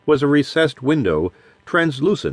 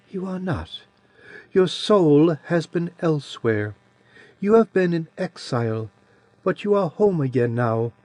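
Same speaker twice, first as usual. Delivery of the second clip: a lightly voiced childlike creature, a touch higher and softer; soft childish voice of an ancient being; sweet childish voice; 4 semitones higher, 3 dB quieter than usual. A narrator's reading. Adiran's voice held.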